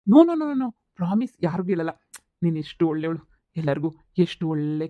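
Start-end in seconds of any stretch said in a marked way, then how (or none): 0.1-0.7 loud, high run of no-no-no; emphatic, high-pitched protest; eager denial. Acted. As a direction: rush to reassure with repeated noes. Hastily promising to keep the secret.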